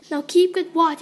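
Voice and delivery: loud, high soldier's order; mocking command. Soldiers to the bound landlord's daughter, loud command.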